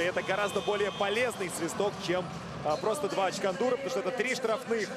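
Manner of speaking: ordinary talk, nothing marked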